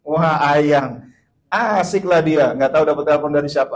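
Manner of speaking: loud, gleeful teasing about the call; excited teasing. Retold or imitated. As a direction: make a big fuss over the caller. Teasing the maid's phone call. (heboh menggoda, suara keras)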